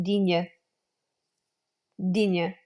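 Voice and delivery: "digne" said the French way, dee-nyuh; nasal ny sound, French style; French-style Middle English. Shown as a demonstration. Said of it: "This is a French word".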